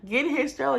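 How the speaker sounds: high, laughing plea for the stroller; pleading mom asking police for the stroller, high and laughing; begging police. Retold or imitated. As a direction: beg with a strained laugh. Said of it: "begging the police".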